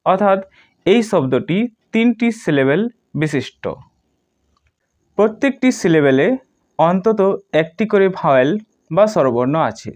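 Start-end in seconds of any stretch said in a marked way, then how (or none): none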